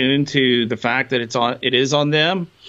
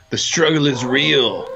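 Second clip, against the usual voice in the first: quoted catchphrase; slower than usual. Retold or imitated. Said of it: "The catchphrase of the day is".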